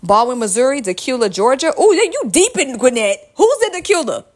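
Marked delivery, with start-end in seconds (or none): 1.8-4.2 high-pitched, surprised reaction; amused surprise. Real, not acted. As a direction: pitch jumps up in delighted disbelief. Reacting to a faraway listener town.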